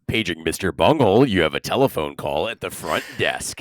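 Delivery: deadpan intercom announcement, low and even; flat echoey PA page. Pretend. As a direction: page him like a hotel front desk. Mock PA page about the band.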